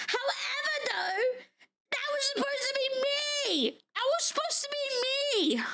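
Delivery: high, hammed-up jealous protest; play-acted wounded envy, pitched high; mock jealous whine. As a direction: wail in mock indignation. Mock jealousy over a game character.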